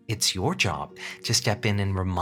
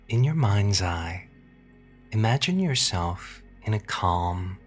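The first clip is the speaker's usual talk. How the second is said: sultry narrator. Acted. Hypnosis session, slow guided relaxation.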